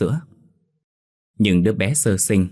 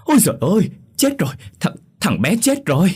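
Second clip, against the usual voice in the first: high piercing shriek of shock, much louder; shrieking cry; 12 semitones higher, 5 dB louder than usual. A narrator's reading. Neighbour shrieking to the crowd that the baby died, high shriek. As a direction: scream out the terrible news. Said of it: "rú lên thật lớn".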